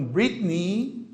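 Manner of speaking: Britney with a drawn-out "ee"; long I ending. Shown as a demonstration. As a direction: say Britney slowly as BRIT-nee. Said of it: "palavra terminada em E Y é um I longo".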